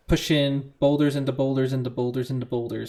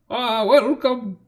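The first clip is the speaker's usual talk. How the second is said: put-on high, slow old-man greeting; 8 semitones higher, 4 dB louder, much slower than usual. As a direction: welcome them as a drawling, high-pitched old man. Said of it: "favorite voice to do is an old man".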